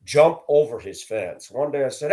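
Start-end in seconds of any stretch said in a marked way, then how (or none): none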